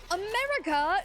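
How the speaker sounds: high, loud rising exclamation; excited amazement. Retold or imitated. Woman hearing they are from America, excited amazement. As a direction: mimic her thrilled question, pitched right up.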